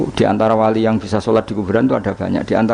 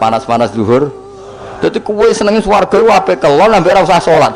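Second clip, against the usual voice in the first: ordinary talk, nothing marked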